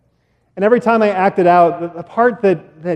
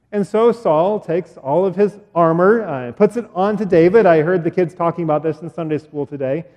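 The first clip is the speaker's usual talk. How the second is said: ordinary talk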